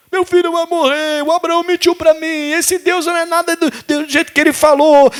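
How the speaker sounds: high-pitched wail of a despairing mother; hammed-up tearful lament; mock crying. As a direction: act out a mother sobbing, voice held up high. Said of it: "A mãe tá chorando".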